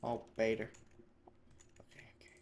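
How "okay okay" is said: barely audible whispered reassurance; nervous whisper. Real, not acted. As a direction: whisper it to yourself, very softly. Tense moment after a scare.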